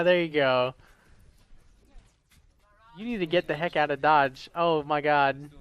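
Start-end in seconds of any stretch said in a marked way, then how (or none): none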